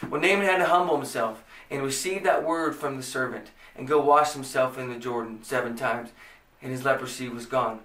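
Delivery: ordinary talk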